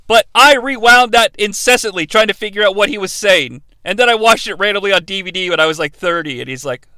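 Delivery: ordinary delivery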